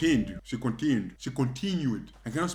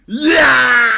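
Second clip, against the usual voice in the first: high, loud yell; mock pain cry; 8 semitones higher, 11 dB louder than usual. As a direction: cry out as the punch lands. Child cries out when punched.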